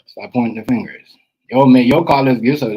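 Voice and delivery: ordinary talk, nothing marked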